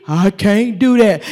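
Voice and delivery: low, slow, feeble excuse; mock whiny refusal. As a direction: mumble it weakly. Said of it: "what came back to me".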